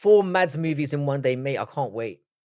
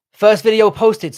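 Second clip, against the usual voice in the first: excited announcement; 6 semitones higher, 10 dB louder, slower than usual. Acted. Reading chat message aloud.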